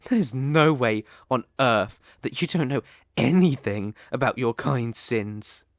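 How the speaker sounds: ordinary talk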